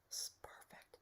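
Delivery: soft unvoiced whisper. Real, not acted. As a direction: let it fade into a hushed breath. Reader drops voice mid-reading.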